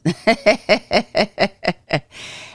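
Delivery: 1 short laugh. Real, not acted. Amused at own modesty.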